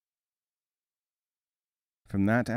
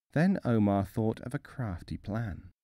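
ordinary delivery

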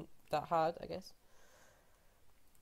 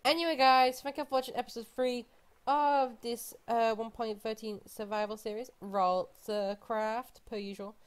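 bright outro; 8 semitones higher, 6 dB louder than usual. Acted. Outro sign-off.

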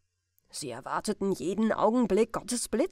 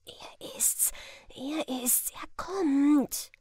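breathless whispered alarm; hushed crowd whisper; excited whisper; 5 semitones higher, slower than usual. Acted. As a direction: whisper it to your neighbour, urgent. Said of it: "Die Menge begann zu flüstern".

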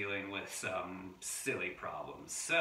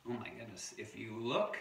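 ordinary delivery

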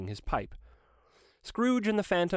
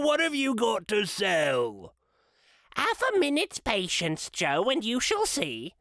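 put-on old Joe greeting his thieving customers; old Joe the rag dealer; 3 semitones higher than usual. A narrator's reading. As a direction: play a wheedling old receiver of stolen goods. Old Joe and woman voiced.

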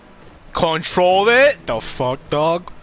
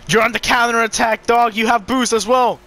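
higher, louder hyped commentary; excited hype; 7 semitones higher, 5 dB louder than usual. Real, not acted. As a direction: pump up the play like a caster.

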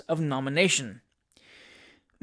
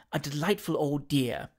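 slightly lowered, softer aside; confidential; 3 semitones lower, 5 dB quieter than usual. A narrator's reading. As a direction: share it softly in confidence.

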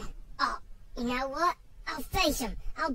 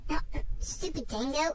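ordinary delivery

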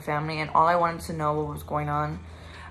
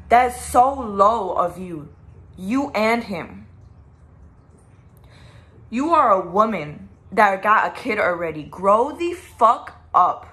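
louder and slow, spitting each word; slow angry scolding, fed up; real anger telling her to grow up; furious; 5 dB louder, slower than usual. Real, not acted. Angry rant, slowed and loud.

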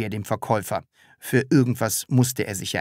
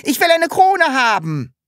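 excited shout; 6 dB louder than usual. A narrator's reading. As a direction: shout it in delight. Sams voice excited.

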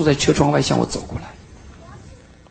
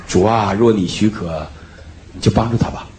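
mock the cautious congregation's prayer; half-laughing imitation of a timid prayer; timid church prayer; 10 semitones lower, 4 dB quieter than usual. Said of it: "我们教会说".

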